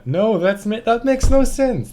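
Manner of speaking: outraged protest at English spelling; exasperated. Real, not acted. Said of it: "frustrated at spelling".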